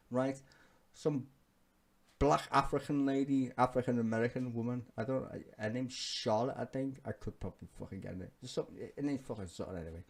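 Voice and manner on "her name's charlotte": ordinary talk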